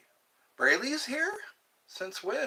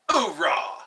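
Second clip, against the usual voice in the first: loud gung-ho soldier; 6 dB louder than usual. Acted. Marine Corps cheer after hymn.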